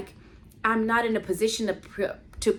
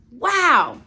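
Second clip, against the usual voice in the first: louder, breathless wow of awe; overwhelmed, lost for words; awestruck wow; 4 semitones higher, 7 dB louder than usual. Retold or imitated. Handed the car keys, she gasps in awe.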